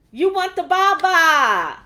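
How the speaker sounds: loud, high, drawn-out taunt; mocking scold. God mocking a grown baby's demand, exasperated taunt. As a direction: throw it back slowly, in hammed-up exasperation.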